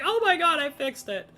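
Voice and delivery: high-pitched replay of brief triumph; mock excitement. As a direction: imitate your own excited squeal. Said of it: "I was all excited".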